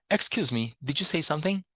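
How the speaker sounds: ordinary talk, nothing marked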